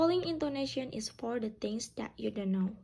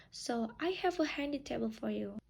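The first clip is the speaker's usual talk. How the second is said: normal talking voice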